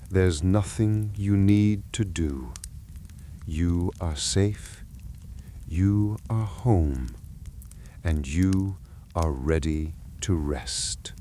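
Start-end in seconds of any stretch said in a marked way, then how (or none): none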